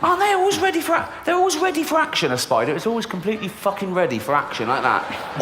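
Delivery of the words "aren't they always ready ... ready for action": high, fast excited voice. Acted. About spiders, high and excited.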